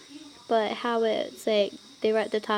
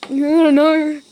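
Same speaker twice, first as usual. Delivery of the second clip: flustered; 4 semitones higher, 11 dB louder, slower than usual. Real, not acted. Flustered reaction to story.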